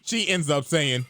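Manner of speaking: ordinary delivery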